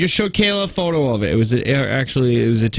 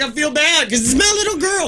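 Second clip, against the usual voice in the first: mock parent; 14 semitones higher than usual. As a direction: tease a guilty mom in a high voice. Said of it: "you're just like".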